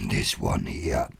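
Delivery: very low, slow put-on voice; deep, drawn-out demo voice; deep and dark. Shown as a demonstration. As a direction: drop right down into a dark accent. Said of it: "like, really deep and dark".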